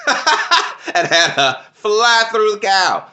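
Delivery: words breaking into high laughter; laughing. Real, not acted. Recapping the funny fight scene to viewers, laughing. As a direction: laugh through the punchline.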